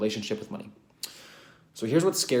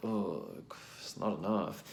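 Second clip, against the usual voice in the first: mock disappointment; 10 dB quieter, slower than usual. Imagined disappointed reaction.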